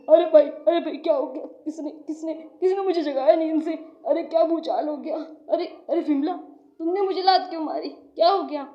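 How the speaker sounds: loud, flustered burst of questions; startled awake. Acted. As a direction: splutter as if kicked out of sleep. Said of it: "लात मारती है".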